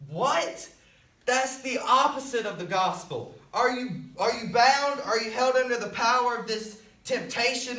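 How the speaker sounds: high, forceful pulpit exhortation; full-force preaching. Real, not acted. Preacher rebuking believers who rely on themselves, impassioned. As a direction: hammer it home to the congregation.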